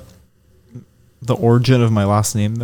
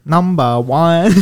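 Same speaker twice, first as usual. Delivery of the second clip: slow, higher caricatured Chinese accent; mock Chinese accent; 4 semitones higher, 4 dB louder, slower than usual. Shown as a demonstration. Friend performing on host's request, exaggerated Chinese accent. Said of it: "in a Chinese accent".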